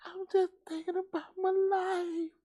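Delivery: high, slow, soft mock-pensive voice; mock pious musing. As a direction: imitate him musing dreamily. Mimicking the man's pious musing.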